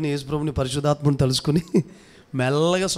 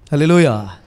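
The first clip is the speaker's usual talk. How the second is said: loud praise shout; congregation call; 2 semitones lower, 9 dB louder than usual. Real, not acted. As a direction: call out for a response. Calling congregation to respond. (గట్టిగా హల్లెలూయా నినాదం)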